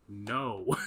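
curt no through a laugh; deadpan no, cracking up. Retold or imitated. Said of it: "the David Lynch approach is".

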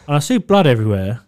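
ordinary talk

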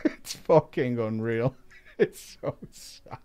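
low, hushed words breaking into laughter; laughing in disbelief. Real, not acted. Reader reacting to the writer's desperation, helpless quiet laughter. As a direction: really laugh through it, voice dropping away.

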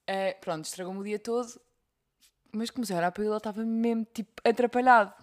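ordinary talk

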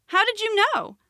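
high-pitched, astonished question; amazed. Acted. Rabbit turning to the frog, amazed. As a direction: ask in wonder, voice lifted high. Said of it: "watched in amazement".

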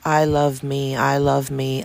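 ordinary talk

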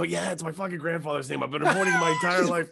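high, loud, fed-up outburst; shouting at the border agent about the name he hates; exasperated shout. Retold or imitated. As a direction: blurt it out, mortified by the old family name. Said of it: "And I go".

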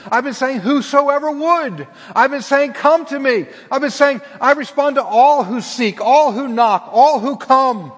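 loud, high pleading voice of God; voicing God. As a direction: plead as God calling his people back. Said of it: "God's saying".

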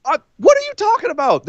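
high pitched disbelief; incredulous. Real, not acted. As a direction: protest in shocked disbelief. Arguing against co-host.

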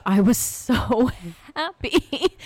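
high, slow, with a laugh; gushing joy. Acted. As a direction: gush it out, delighted. Wept with joy at gas price.

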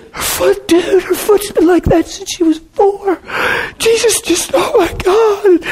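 very high, tearful squeak; imitated crying. Friend reports a healed foot, imitated tearful crying. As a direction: imitate a friend blubbering in overwhelmed amazement. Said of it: "he's just crying".

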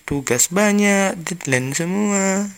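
high, loud, dragged-out grumble; exasperated complaint. Real, not acted. Student venting about coursework, exasperated drawn-out wail. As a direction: stretch each gripe out loudly. Ranting about college workload.

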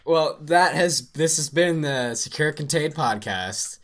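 slow, high, hammed-up outro; podcast outro. Real, not acted. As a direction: announce the sign-off grandly. Outro announcement.

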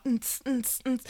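soft wordless "nts" pulses; beatbox a thin dance rhythm; imitated club beat. Sister describing a family always dancing, imitated club beat.

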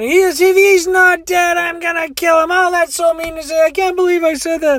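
high-pitched hysterical mock rant; crying. Crying woman threatens then regrets it, hammed-up hysterics. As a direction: run on in an exaggerated high wail. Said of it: "she's crying and crying and crying".

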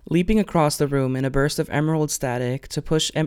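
ordinary delivery

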